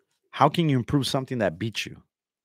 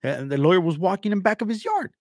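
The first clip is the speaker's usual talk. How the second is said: higher-pitched mock complainer; mocking imitation; 5 semitones higher, 4 dB louder than usual. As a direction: mimic him telling his tale, pitch climbing. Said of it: "Like, he says".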